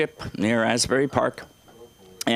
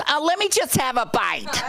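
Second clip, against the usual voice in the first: much higher, wheedling little voice; mock sweet asking; 12 semitones higher than usual. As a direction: coax a taste, high and sugary. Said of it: "Everyone at the table goes".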